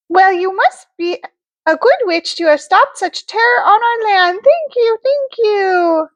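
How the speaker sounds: high, sweet fairy voice; sing-song good witch; Glenda the good witch. Acted. Glenda thanks.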